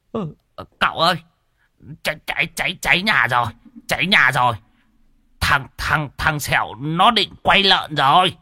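high, stuttering panic; timid, halting voice pitched high; frightened stammering. A narrator's reading. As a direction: stammer out the alarm from behind someone's back. Cowering man stammers about fire.

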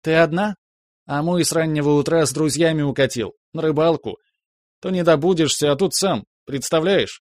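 high, excited gossipy chatter; rapid chatter. Acted. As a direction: rattle it off, breathless. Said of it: "затараторила Марина". (тараторит взахлёб)

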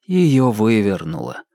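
usual speaking voice

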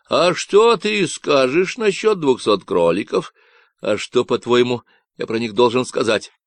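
loud, nasal Texan ranting about ecologists; a cocky stranger sounding off; Davy's Texan voice. Acted. Stranger on the radio ranting about ecologists, nasal Texan.

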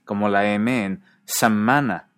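semana with the m held long, slowly. Shown as a demonstration. Teacher showing Catalan long consonants, drawn out. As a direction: hold the m in semana. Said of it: "consonantes largas".

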